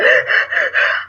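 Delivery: imitated shuddering breaths; shaking gasps. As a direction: act out uncontrollable shivering in quick gasps. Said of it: "I started just shaking uncontrollably".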